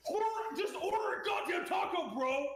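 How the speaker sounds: high shouting frat bro; frat boy yell. Retold or imitated. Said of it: "random frat boys say".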